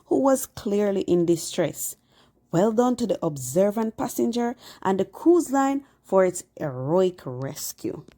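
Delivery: normal talking voice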